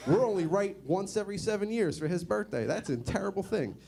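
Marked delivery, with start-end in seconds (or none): none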